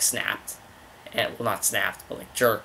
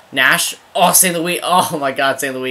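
loud, high cry at a missed chance; exasperated gamer shouting at the play; excited outcry; 3 semitones higher, 7 dB louder than usual. Real, not acted. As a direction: burst out in disbelief, then ease off. Big missed chance in game.

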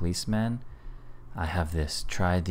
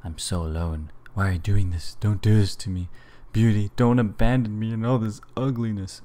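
desperate pleading; 4 semitones higher, 6 dB louder than usual. Acted. Pleading at an abandoning door.

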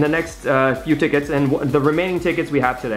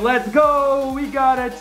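shrieking jackpot winner; 9 semitones higher, slower than usual. Real, not acted. Celebrating the win.

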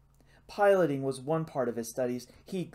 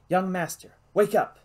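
loud, high-pitched call to a sleeper; urgent wake-up call; 5 semitones higher, 7 dB louder than usual. A narrator's reading. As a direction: rouse him sharply, voice raised. Rousing a sleeping boy.

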